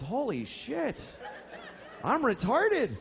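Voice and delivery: high, acted shock; stunned realization. Child arriving at camp and realizing, stunned realization. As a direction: re-enact a dawning horrified discovery. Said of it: "I looked around and I realized".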